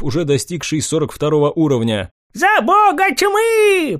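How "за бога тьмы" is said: high, drawn-out battle cry. Acted. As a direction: cry out slowly for the god. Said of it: "поддержал его староста".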